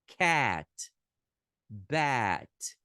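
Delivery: "cat", "bat" with an open é vowel; teacher demo of the open a; English a vowel. Shown as a demonstration. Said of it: "Outra palavrinha que usaria esse é".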